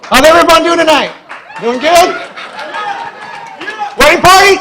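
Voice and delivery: loud, high call-out to the guests with a laugh; crowd hype. Real, not acted. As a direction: pump up the room. Best man hyping the crowd.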